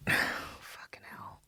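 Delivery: real whispered curse under a sigh; mortified whisper. Real, not acted. Speaker realising she misread Hamsa as Hamas, mortified whisper. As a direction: sigh it out, barely audible. Mortified by own wrong word.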